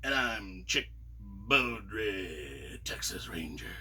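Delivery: low cowboy introduction fading to a whisper; Western hero voice. Retold or imitated. Speaker at the next door playing Chick Beaudry, low Western voice. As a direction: growl it out like a Texas Ranger. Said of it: "we started talking that way ourselves".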